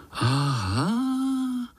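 high, stretched sound of understanding; dawning realisation. A narrator's reading. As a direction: let it rise as the penny drops. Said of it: "řekli pavoučci". (protáhlé pochopení vysokým hlasem)

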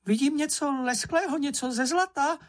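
very high, excited little creature; Čenich shouting. A narrator's reading. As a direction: squeak with eager discovery. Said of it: "zakřičel Čenich". (vzrušený pisklavý hlásek)

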